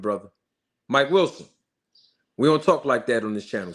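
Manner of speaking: ordinary talk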